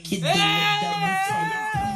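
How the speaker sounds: ordinary delivery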